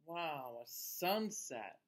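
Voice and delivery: ordinary delivery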